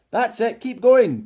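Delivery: high, loud call to the group. A narrator's reading. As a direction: call it forward from the back of the line. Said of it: "called Ralph from the back".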